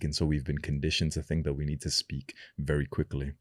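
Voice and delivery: usual speaking voice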